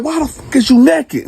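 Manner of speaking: high, loud furious yell; retold yell. Yelling at naked cellmate over the bed, furious shout. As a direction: demand an answer at full volume. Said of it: "And now I'm yelling at this time".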